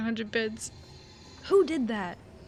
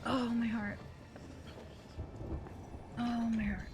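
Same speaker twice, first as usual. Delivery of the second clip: shaken; 10 dB quieter than usual. Real, not acted. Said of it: "actually shaking".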